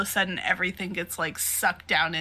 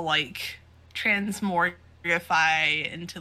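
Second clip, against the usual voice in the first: ordinary delivery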